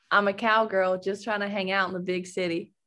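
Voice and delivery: Australian's imitation American accent with a slight Southern touch. Shown as a demonstration. Athlete repeats a line given by the interviewer, put-on American accent. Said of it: "can you do an American accent".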